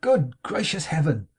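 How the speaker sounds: put-on an old friend appears at the door, cried out in delight; crying out. A narrator's reading. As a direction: burst out in delighted astonishment. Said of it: "cried out".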